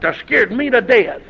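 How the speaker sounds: ordinary talk, nothing marked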